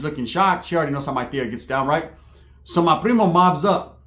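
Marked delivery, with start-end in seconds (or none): none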